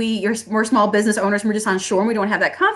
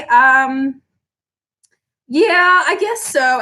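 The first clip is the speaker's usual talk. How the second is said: higher, dithering and slow with long ums; hesitant caving seller; 4 semitones higher, slower than usual. Retold or imitated. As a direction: waffle and give in to the haggler. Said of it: "The song and dance would be like".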